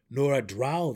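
loud, pointed gnome jab; pointedly. A narrator's reading. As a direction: land it as a pointed reminder. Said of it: "the deep gnome added pointedly".

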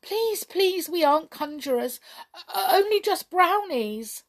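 high, stammering plea; frightened pleading. A narrator's reading. Brownies begging the king for mercy, frightened. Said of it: "very frightened indeed".

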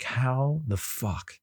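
slow, stunned disbelief; drawn-out what happened; shocked disbelief. Retold or imitated. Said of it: "And I'm just like".

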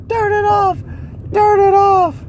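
squealing mock plea to make it stop; mock desperate plea. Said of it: "I'm like".

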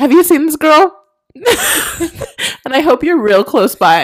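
high, laughing pretend plea; mock drunk retake. Redoing the drunk bouncer question for the recording, laughing. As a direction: redo the tipsy bouncer bit, giggling. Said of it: "Re-record it".

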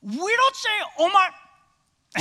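very high imitated small-boy voice; Banner. Preacher as three-year-old nephew refusing to repeat it, high child voice. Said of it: "he's got this distinct voice".

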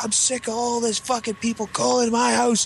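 high-pitched ranting yell that keeps climbing; screaming dad. Retold or imitated. Dad screaming at his son about the school's calls, a high rant. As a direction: unload on the kid like an enraged father. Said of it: "you know, screaming and yelling".

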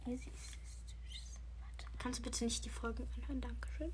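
unvoiced whisper to the side. Real, not acted. Whispering aside to a friend during the headphone challenge. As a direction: whisper so the listener can't catch it.

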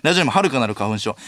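usual speaking voice

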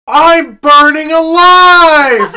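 high, drawn-out hammed-up scream; burning scream. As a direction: scream it slowly like you're on fire. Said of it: "the kid was like".

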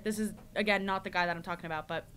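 normal talking voice

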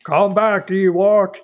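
stiff, phony robotic villain; a hint of a fake mechanical Vader. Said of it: "mechanical sounding voice".

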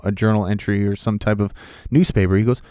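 ordinary delivery, nothing marked

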